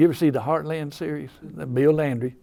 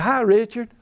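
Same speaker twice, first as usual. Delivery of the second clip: bright lifted greeting, higher and louder; 7 semitones higher, 5 dB louder than usual. Retold or imitated. [name] greets the blacksmith.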